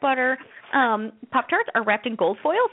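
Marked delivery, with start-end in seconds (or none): none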